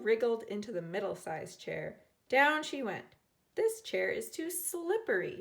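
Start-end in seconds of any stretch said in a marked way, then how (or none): 3.5-5.4 higher, startled as she slips; a little gasp of surprise; mild startled complaint. A narrator's reading. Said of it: "she gasped".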